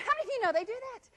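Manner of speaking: usual speaking voice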